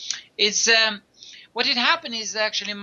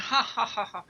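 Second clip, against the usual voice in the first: laughing; 4 semitones higher, 6 dB quieter than usual. Retold or imitated. Old man laughing in story.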